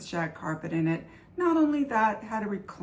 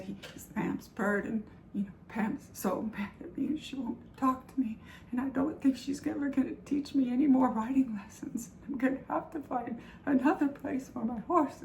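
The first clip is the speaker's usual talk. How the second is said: higher-pitched mock sobbing complaint; imitated tearful wail; 6 semitones higher than usual. Crying daughter explains to mom about Pam, imitated tearful wail. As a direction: retell it as an upset, tearful plea. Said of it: "I'm crying so hard".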